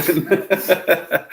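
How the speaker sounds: laughing through a put-on British "struggling"; giggly British-accent imitation; laughing mock British. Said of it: "We think y'all's accent's weird".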